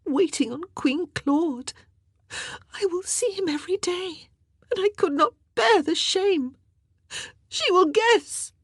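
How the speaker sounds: high sobs rising to a wail; piteous crying, climbing in pitch; weeping into a wail. A narrator's reading. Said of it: "Her cries rose to a piteous wail".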